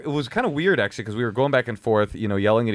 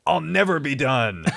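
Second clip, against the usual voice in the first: raised, drawn-out mock battle cry; defiant knight; 4 semitones higher than usual. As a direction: declare it like a knight refusing to yield. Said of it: "I'm fighting a knight".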